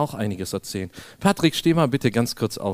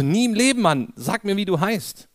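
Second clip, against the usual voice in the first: much higher, louder incredulous cry; mock disbelief; 7 semitones higher, 3 dB louder than usual. Preacher retelling how he doubted Patrick's name, loud mock disbelief. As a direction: refuse to believe it, loudly teasing.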